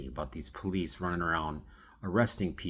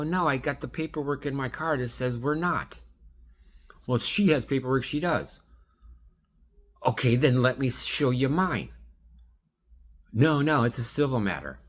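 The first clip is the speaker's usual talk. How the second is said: both sides replayed higher and louder; heated back-and-forth, acted out loud; replaying a shouting match; raised retold argument; 5 semitones higher, 3 dB louder than usual.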